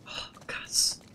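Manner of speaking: breathless awed whisper "krass". Real, not acted. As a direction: whisper it in shock. Stunned whisper after twist. (geflüstert, fassungslos)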